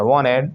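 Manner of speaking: "wanted" said as wah-ned; dropped t. Shown as a demonstration. Teacher demonstrates for students, "wanted" without its t. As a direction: let n swallow the t in "wanted". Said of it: "टी गायब कर दिया".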